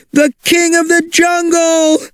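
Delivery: high excited cry of alarm. Acted. Cave aliens spotting the giant dinosaur, excited high cry. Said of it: "cried the cave aliens".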